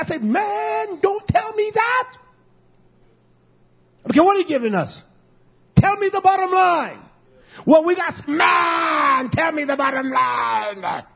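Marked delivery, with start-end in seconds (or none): 0.2-2.2 high, slow, exasperated retort; exasperated refusal. Retold or imitated. Pastor retells rejecting the contractor's excuse, high and exasperated. 4.1-11.0 loud, high demand, slowing at the end; mock shout. Pastor replays shouting at the contractor for the bottom line, loud and exasperated.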